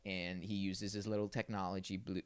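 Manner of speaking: ordinary delivery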